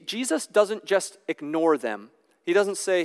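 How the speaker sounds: ordinary talk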